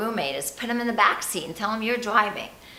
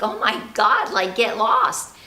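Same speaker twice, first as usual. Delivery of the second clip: louder, fed-up retort; exasperated brush-off; 3 semitones higher, 6 dB louder than usual. Acted. You answering a roommate who criticizes all day, exasperated. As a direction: tell a nag off, drawing out the last words. Imagined reply to a nagging roommate.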